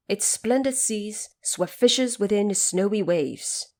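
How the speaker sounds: usual speaking voice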